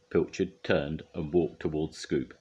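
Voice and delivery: ordinary delivery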